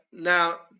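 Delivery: ordinary talk, nothing marked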